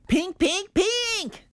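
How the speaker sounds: squealing pink, pink, pink rising high; a girl begging her mommy for pink, excited. A narrator's reading. Girl squealing for pink frosting, excited. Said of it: "I said".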